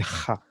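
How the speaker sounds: ha with friction from the back of the throat; guttural kh. Shown as a demonstration. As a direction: scrape the start of ha like kh. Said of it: "the guttural sounds".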